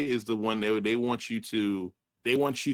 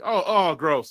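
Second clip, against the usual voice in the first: louder, higher grossed-out groan; disgusted; 5 semitones higher, 6 dB louder, slower than usual. Retold or imitated. Reacting to the flirty line, loud mock disgust. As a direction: recoil in disgust. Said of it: "I'm like".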